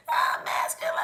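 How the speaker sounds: strained, voiceless croak; wounded-cat voice. Acted. Reader mocking a voice nobody wants, strained wounded-cat croak. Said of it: "a wounded cat".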